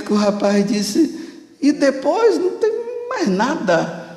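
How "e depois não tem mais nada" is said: much higher, flustered reply; cornered soldier, flustered high pitch; stumped answer. Retold or imitated. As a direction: blurt it out high and lost. Young man finally stuck for answer.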